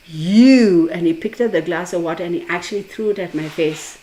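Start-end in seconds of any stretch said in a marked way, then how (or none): 0.1-0.9 loud, drawn-out angry YOU; angry accusation. Retold or imitated. Enraged client to the card reader, loud accusation. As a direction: throw the word out in anger. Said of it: "he looked at me and he said".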